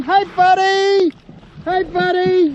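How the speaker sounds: high, loud, slow friendly call. Real, not acted. Kayaker calls to the whale, slow loud call. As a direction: stretch it out to reach the whale. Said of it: "calling out to him".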